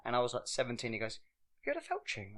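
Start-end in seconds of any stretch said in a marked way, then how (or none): 1.2-2.4 high, quiet quoted voice; lightly voiced coworker; quoting coworker.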